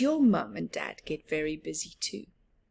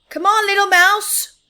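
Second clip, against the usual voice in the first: high, loud call across the garden; 13 semitones higher, 14 dB louder than usual. Acted. Big Mouse calling Little Mouse across the garden, loud call. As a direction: call him over from far off. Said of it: "he called".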